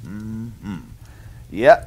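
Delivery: usual speaking voice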